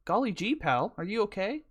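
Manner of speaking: put-on high, lilting old-time cowboy. Cowboy checks on a man lying in the road, high folksy voice. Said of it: "you know how cowboys used to talk".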